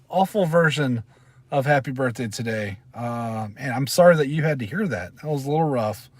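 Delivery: ordinary delivery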